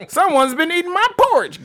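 high, loud mock growl; a squeaky mama bear trying to growl. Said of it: "growled the Mama Bear".

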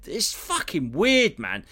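ordinary talk

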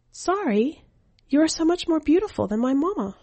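a hint of a shy little boy paying a compliment; young boy. Narrator voices the young boy.

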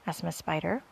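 usual speaking voice

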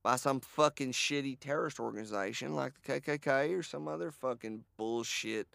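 ordinary delivery

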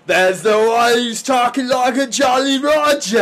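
loud, high mock British pirate voice; hammed-up British accent, shouted high. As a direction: shout it like a jolly pirate Brit. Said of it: "those guys are really British".

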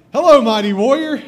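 loud, high call of hello; the angel hails a frightened Gideon, loud and high; booming angel greeting. Acted. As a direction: boom it out like a herald angel. Angel greets hiding Gideon loudly.

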